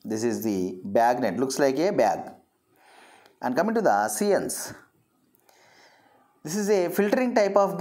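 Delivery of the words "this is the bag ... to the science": ordinary talk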